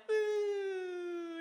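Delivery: long high "ooh" gliding gradually down; high, sustained descending hoot; imitated slow sinking noise. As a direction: mimic slowly sinking with one drawn-out falling tone. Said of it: "It's more like".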